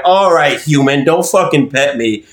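ordinary talk, nothing marked

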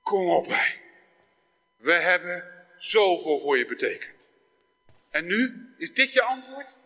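a hint of a hurt parent; desperate parent. Parent to child who ignores him, lightly hurt. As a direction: plead lightly like a let-down father. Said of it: "de wanhopige ouder die naar zijn kind zegt".

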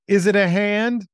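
high, apprehensive question with rising dread; anxious question. Acted. Daryl with his eyes closed asks Brad, anxious and high.

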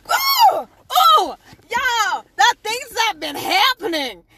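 loud, high whoop to the listeners; loud, high-pitched dishing outburst; excited exclamation. Real, not acted. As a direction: holler it, high and worked up. Exclaims over all that has happened.

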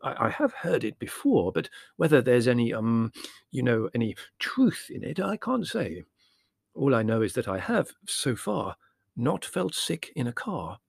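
hesitant, careful scholarly figure; stammering, measured caution; judicious scholar. Acted.

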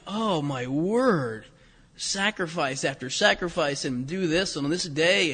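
slow, low and soft, bored groaning delivery; hammed-up tedium, heavy and slow; mock weariness. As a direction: drag it out like a reader losing heart. Reader bogged down in Leviticus.

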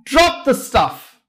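an understated storyteller's shout; yelled. A narrator's reading. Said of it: "yelled out".

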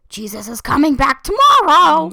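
loud, high street-preacher proclamation; street preacher shout. Retold or imitated. Mimicking a Times Square street preacher, loud proclamation. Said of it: "people in Times Square ... be like".

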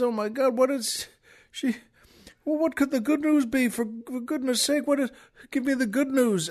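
high, frantic pleading from a distraught husband; upset husband. Retold or imitated. Husband begs the troopers, high and frantic. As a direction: beg for the news in panic. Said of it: "He's so upset".

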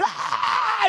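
put-on high wordless child's wail; imitating a crying child. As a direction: mimic an unintelligible cry. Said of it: "it's nothing intelligible coming out".

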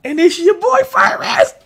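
high, loud hype shout; hollered big reveal; excited announcement. Real, not acted. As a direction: shout out a surprise arrival. Announcing a character's sudden arrival.

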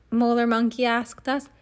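ordinary delivery

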